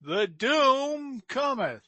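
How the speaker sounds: slow, high, loud doom proclamation; ominous sign-off. Pretend. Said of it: "wanna close it off?".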